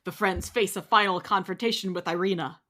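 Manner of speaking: normal talking voice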